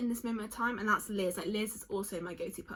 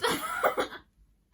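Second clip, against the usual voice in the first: laughing; 3 dB louder than usual. Real, not acted. Laughs at friend's joke.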